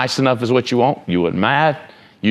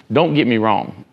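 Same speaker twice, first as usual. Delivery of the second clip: ordinary talk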